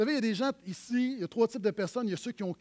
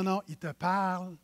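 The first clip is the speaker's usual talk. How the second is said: usual speaking voice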